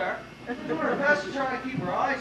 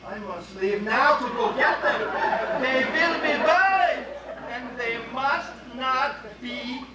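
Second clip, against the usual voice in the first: put-on German accent. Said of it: "it has a German accent".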